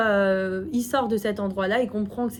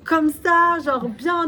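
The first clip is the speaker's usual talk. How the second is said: higher, louder, slow emphatic demonstration; exaggerated show; 6 semitones higher, 5 dB louder, much slower than usual. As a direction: overdo it to show he gets it. Shows exaggerated bowing.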